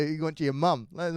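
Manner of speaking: ordinary talk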